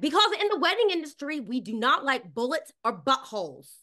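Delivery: high, loud declaration; emphatic explanation. Retold or imitated. To Tamara, explaining why roses get spun, high and emphatic. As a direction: raise the pitch on because. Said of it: "I was like".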